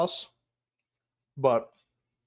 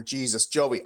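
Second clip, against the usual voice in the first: usual speaking voice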